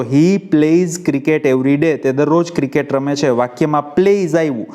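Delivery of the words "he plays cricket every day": very slow, each word laid out; slow read-aloud. Shown as a demonstration. Teacher reading the sentence aloud for students, very slow. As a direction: spell the sentence out for the class, slowly. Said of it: "તો વાક્ય વાંચો".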